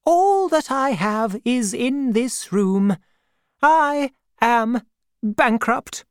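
put-on a kindly, no-nonsense old aunt greeting an old acquaintance; elderly aunt. A narrator's reading. Aunt's voice, held.

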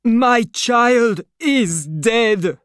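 father shouting at the Marquis, anguished and loud; anguished cry. A narrator's reading. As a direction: wail it out as a broken father. Grieving father cries out.

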